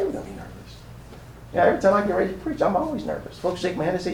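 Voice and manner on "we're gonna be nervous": hushed, confiding whisper of nerves. Shown as a demonstration. Preacher confessing his nerves to the church, whispered. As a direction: drop to a breathy aside as if admitting a secret. Whispered repeat of nervous line.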